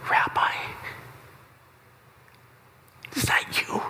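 a breathless, hushed recognition; awed whisper. Acted. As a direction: whisper it in disbelief. Mary recognizes Jesus, awestruck.

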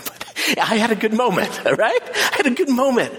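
sheepish laugh while insisting; giggling embarrassed admission. Real, not acted. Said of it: "please don't think".

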